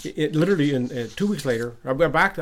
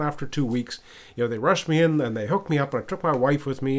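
ordinary talk

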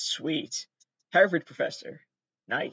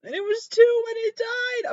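near tears; 5 dB louder than usual. Real, not acted. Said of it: "I'm gonna cry for real".

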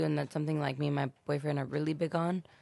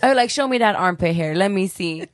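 louder playful mom demand; teasing demand; 10 dB louder than usual. Retold or imitated. Mom teasing her teen son, loud and playful. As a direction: boss him about it loudly, teasing.

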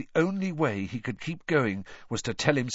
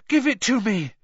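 urgent demand; 9 semitones higher, 5 dB louder than usual. A narrator's reading. Harry demands broom from taunting mermaid.

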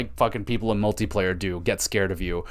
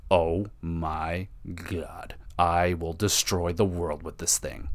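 awed menace; 4 semitones lower, 5 dB quieter, much slower than usual. Retold or imitated. Discovering the laser's power.

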